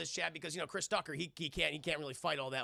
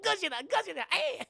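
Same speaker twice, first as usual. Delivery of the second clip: high, loud imitation of Japanese movie panic; hammed-up monster-movie alarm; mock panicked cry; 13 semitones higher, 5 dB louder than usual. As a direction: cry out like a fleeing film crowd. Repeats panicked Godzilla cry.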